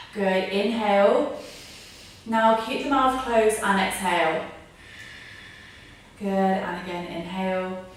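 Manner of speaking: calm breathing guidance; yoga instruction. Acted. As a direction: lead the class through each breath. Guides a breathing exercise.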